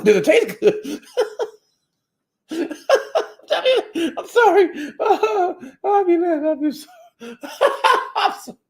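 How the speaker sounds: high, squeaky laughter breaking up the words; cracking up, voice shot way up; real laughing fit. Real, not acted. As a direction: apologize while helpless with laughter. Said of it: "I got a good laugh".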